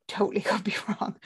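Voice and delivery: breathy half-laughed whisper, fast; a quick giggly whisper; whispered aside. Real, not acted. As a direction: slip the disclaimer in under your breath.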